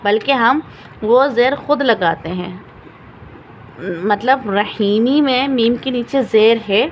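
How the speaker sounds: ordinary delivery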